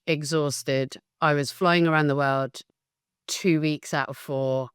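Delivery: normal talking voice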